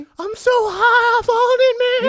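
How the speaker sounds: loud, slow, very high stoned wail; high whale. Acted. Whale confessing to his translator, loud stoned wail. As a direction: stretch it out as a blissed-out whale. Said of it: "Skinny's high on".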